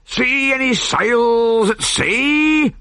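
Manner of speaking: long drawn-out hail to the lookout; calling up. A narrator's reading. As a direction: call up to the crow's nest. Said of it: "he called to the pirate on lookout".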